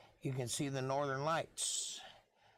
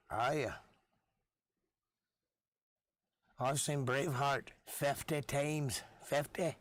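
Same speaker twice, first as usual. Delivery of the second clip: slow, put-on Scottish accent. Podcaster gushing about Braveheart, in a put-on Scottish accent. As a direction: roll it out like a Braveheart Scot. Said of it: "I could just talk in accents".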